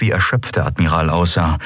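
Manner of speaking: ordinary delivery, nothing marked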